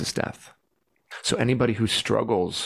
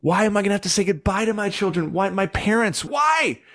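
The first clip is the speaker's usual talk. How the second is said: high, loud desperate questions building to a shout; hurling every why at God until she breaks into a yell; 11 semitones higher, 4 dB louder than usual. Retold or imitated. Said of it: "crying and I'm, like, yelling at God".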